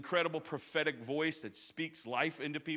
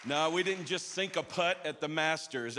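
usual speaking voice

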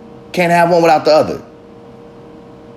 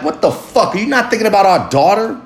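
anger with pitch lifting on the question; indignant demand, rising higher at the end; angry outburst; 5 semitones higher than usual. Acted. Father confronting his ex about their daughter, angry. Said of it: "I'm so filled with anger".

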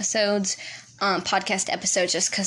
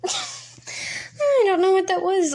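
high, slow, laughing through the words; flustered laughing; 10 semitones higher, much slower than usual. Real, not acted. Flustered after her slip to listeners, laughing high and slow. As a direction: squeal it out in embarrassed laughter.

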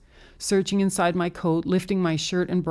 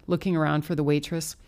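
plain reading voice

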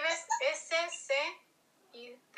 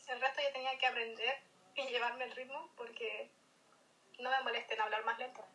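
ordinary talk

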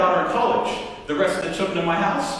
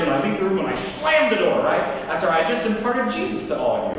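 normal talking voice

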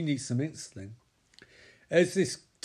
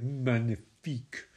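French pronunciation; 3 semitones lower, slower than usual. Retold or imitated. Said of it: "he's like".

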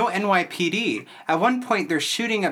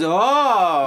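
loud, higher drawn-out oh; mock realization; 4 semitones higher, 6 dB louder than usual. Teasing co-host over his reaction to the joke, mock realization. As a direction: imitate someone suddenly getting it. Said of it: "He goes".

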